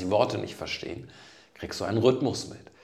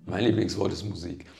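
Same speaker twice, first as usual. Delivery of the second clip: ordinary talk, nothing marked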